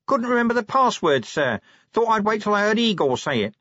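high-pitched voice from the shadows; shady watchman. A narrator's reading. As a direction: make the excuse in a high voice.

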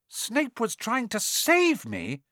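high, slow, astonished question; incredulous. A narrator's reading. Harry learns Snape protected him, incredulous. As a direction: echo it back in disbelief.

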